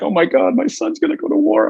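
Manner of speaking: high, rising hammed-up parental panic; mock panicked parents. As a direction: play the freaked-out mother, pitch shooting up. Parents freaking out retold.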